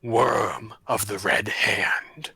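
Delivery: loud, slow, low boom; booming monster voice. Acted. Dragon announces itself to intruders, loud and booming. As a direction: boom it out slowly, shaking the foundations. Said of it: "a loud, booming voice that shakes".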